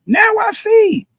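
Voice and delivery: slow, high, loud disbelieving cry; exasperated cry. Real, not acted. Exasperated outburst at the rapper.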